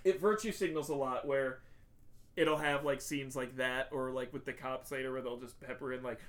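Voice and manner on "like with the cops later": ordinary delivery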